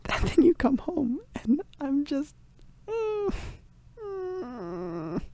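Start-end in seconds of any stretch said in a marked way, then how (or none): none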